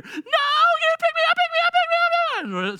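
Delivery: put-on high, loud whiny baby wail; mock crying toddler. Parent imitating daughter Nola's high crying plea. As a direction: wail like a toddler begging to be held.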